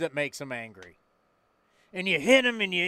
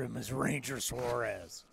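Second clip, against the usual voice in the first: lower, softer gunfighter voice; low gunslinger voice; 7 semitones lower, 11 dB quieter than usual. Pretend. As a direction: growl it like an old Western gunfighter. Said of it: "I don't think he sounds like that".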